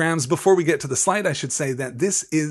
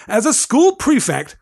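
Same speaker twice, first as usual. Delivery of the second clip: high, loud, officious protest; indignant prefect; 9 semitones higher, 8 dB louder than usual. Acted. Percy invoking his rank to Malfoy, loud and indignant. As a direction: pull rank loudly.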